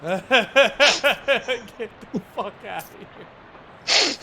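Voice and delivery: drawn out through laughter; laughing repeat. Real, not acted. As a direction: echo the line while cracking up.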